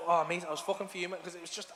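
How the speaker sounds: usual speaking voice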